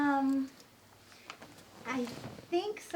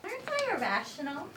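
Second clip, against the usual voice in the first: ordinary talk, nothing marked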